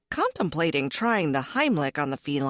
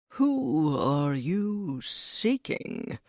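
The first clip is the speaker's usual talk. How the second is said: sly cat voice; 2 semitones lower, much slower than usual. Acted.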